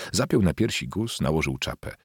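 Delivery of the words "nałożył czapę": ordinary delivery, nothing marked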